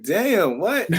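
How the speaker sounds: stunned. Retold or imitated. Shocked by guru's question.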